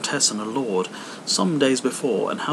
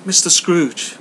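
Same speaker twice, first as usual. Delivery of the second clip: higher, loud cheery toast; Bob's toast; 8 semitones higher, 8 dB louder, slower than usual. Acted. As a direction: raise a glass to him. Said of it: "Bob toasts his employer".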